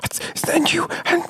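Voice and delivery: hushed, breathy argument; whisper-shout like in a library; whisper yelling. Pretend. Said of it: "Like a whisper yelling".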